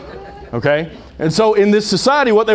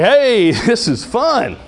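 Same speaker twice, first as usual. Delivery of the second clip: drawn-out, higher mock-cheerful voice; sarcastic party cheer with a laugh; 4 semitones higher, much slower than usual. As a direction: fake delight at something grim, stretched slow. Mocking depressing Super Bowl commercials.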